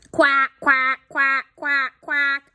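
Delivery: high, loud quacking character voice; shouting duck. Acted. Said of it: "shouts Dottie".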